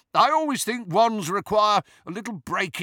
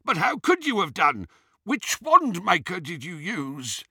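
high-pitched, incredulous query; puzzled questioning; 7 semitones higher than usual. A narrator's reading. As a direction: press for an answer, pitched high. Travers questioning in surprise.

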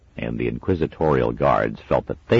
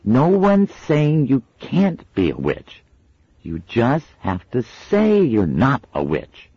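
put-on severe girl; 11 semitones higher, 5 dB louder than usual. A narrator's reading. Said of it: "she added severely".

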